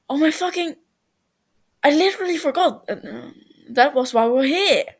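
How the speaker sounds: high, loud and fast, blurting it out; the penny drops mid-sentence; sudden realisation. Acted. Tubbo suddenly remembers.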